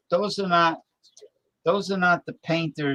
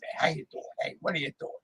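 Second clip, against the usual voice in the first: whispered greeting; 3 semitones higher, 8 dB quieter, much faster than usual. Pretend. Hushed repeat of the greeting.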